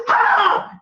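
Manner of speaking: loud high "Ah!" battle scream; surprise scream. Shown as a demonstration. Woman startling her attacker, loud high scream. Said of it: "So if a woman says".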